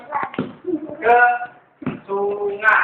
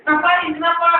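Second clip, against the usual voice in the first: put-on a higher, worried father calling to his child; 7 semitones higher than usual. The father finds his hurt child, higher and worried. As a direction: fret over the child as the father.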